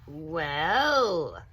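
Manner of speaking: loud, drawn-out menacing well; sly big alligator. A narrator's reading. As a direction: savour it like a predator. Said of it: "said the big hungry alligator".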